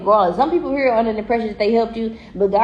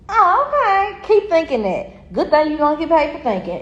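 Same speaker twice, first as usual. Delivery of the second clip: high, drawn-out mocking oh okay; smug slow sneer at someone who doubted her; sarcastic; 6 semitones higher than usual. Real, not acted.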